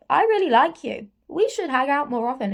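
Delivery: light, pleading impression of an eager admirer; mock eager suitor. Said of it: "And he's just like".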